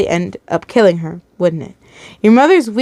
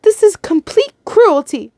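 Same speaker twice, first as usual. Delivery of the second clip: high, slow, distressed outburst; bursting out; 9 semitones higher than usual. A narrator's reading. Captive accusing the captors of cruelty, outburst. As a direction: protest it high and drawn out. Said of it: "you bursted out".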